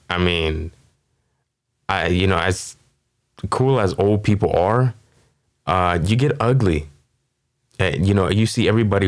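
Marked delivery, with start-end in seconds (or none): none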